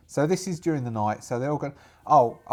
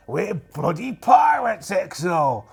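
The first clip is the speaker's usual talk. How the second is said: loud, high, drawn-out exclamation; shouted pirate rebuke; indignant pirate shout; 4 semitones higher, 5 dB louder, much slower than usual. Acted. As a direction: roar at your timid crewmate. Captain rebukes his hesitant crewmate.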